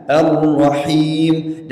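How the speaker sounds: slow "Ar-Rahim" with a distinct throaty h; clear ha. Shown as a demonstration. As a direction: draw out "Ar-Rahim", sounding the ha clearly. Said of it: "Dan jelaskan ha".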